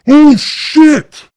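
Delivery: high, loud panicked scream; god-awful scream. Retold or imitated. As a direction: scream it in sudden terror. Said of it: "with this god-awful scream".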